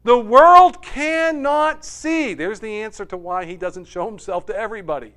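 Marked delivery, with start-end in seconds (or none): 0.0-2.3 much higher and louder, slowly; slow emphatic proclamation. Acted. Preacher driving home to congregation, slow loud emphasis. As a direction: declare it word by word.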